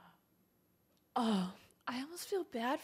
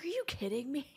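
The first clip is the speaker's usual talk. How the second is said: whispered mock disbelief at the character; whispered quote; 3 semitones higher, 4 dB quieter than usual. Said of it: "He's like".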